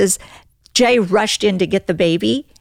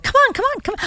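high-pitched imitation of beckoning; quick, repeated coaxing to follow; mock husband calling her over; urging; much faster than usual.

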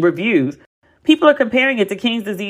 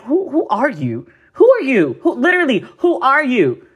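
indignant, higher-pitched disbelief; higher, emphatic, dismissive questioning; incredulous challenge; 6 semitones higher than usual. Retold or imitated. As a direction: demand it as if truly baffled. Incredulous retort to rapper's dismissal of [name].

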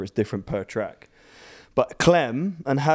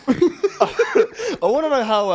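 laughing; 3 dB louder, much slower than usual. Real, not acted. Laughing on the line.

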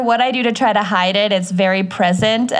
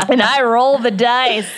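said through a laugh. Real, not acted. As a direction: giggle through the line. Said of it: "playful intro through laughter".

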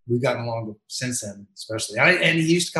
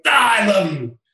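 mock fan adoration; 8 semitones higher, 7 dB louder than usual. Heckler suddenly flips to affection.

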